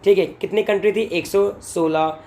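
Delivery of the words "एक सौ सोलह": slow answer. Shown as a demonstration. Teacher spells out the answer.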